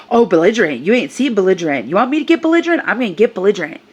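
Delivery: loud mocking threat with big pitch swoops; imitated belligerent rant. Shopper hurling the word back at narrator, mock belligerence.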